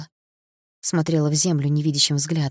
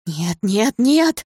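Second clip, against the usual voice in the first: rising to a loud high cry; desperate denial; 5 semitones higher, 5 dB louder, much slower than usual. A narrator's reading. Polina rejecting news of Dima's death, desperate denial. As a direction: repeat it, climbing into a cry.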